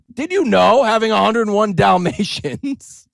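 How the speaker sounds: mock breathless headline; mock news hype. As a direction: sell the fake study like breathless news. Mocking a third hyped claim.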